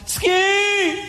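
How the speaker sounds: high, long holler down a hill; imitated warning yell. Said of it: "heard a guy yell".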